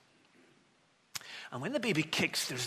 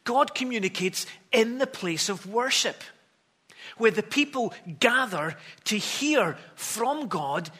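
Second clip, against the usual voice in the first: higher and louder pulpit proclamation; fervent preacher proclaiming to the congregation; preaching at full force; 7 semitones higher, 5 dB louder than usual. Acted. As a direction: build the sermon to a ringing climax. Preaching climax, raised voice.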